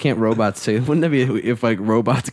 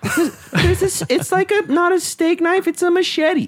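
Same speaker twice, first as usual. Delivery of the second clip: pitched way up, slower comic squeal; mock high voice. Describing Mom's huge knife to the co-hosts, mock high voice.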